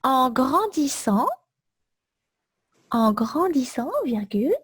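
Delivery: chunks repeated, punctuation read out; slow dictation. Shown as a demonstration. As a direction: dictate it slowly for note-taking. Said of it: "Je répète tout le texte".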